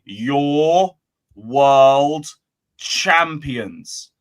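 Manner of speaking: YOUR, WORLD, CHAMPIONS, each word stretched and set apart; "Your... world... champions", long pauses between the words; said slowly. Shown as a demonstration. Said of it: "I'll say it slowly".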